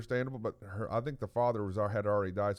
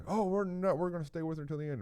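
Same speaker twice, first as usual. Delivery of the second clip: higher-pitched mock refusal; imitating protesting siblings; 7 semitones higher, faster than usual. Siblings insisting on staying with their sister, protesting. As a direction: object quickly in a raised voice.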